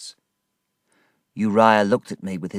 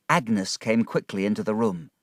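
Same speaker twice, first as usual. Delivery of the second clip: ordinary delivery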